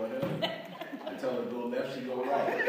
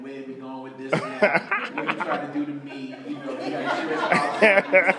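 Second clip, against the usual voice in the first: rising louder, laughing alarm; mock fear that he's up to something; suspicious wife; 11 dB louder than usual. Wife suspicious of her husband's date night idea, laughing.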